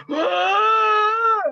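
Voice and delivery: high, loud mock scream of pain; imitated pained cry. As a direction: yelp like a man buried under rocks. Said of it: "It sounds painful".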